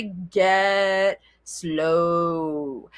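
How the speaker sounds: very slow, drawn-out words; deliberately unhurried, with long vowels; slowed right down. Shown as a demonstration. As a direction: stretch each word out to model slow talking. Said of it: "Maybe you're talking too fast".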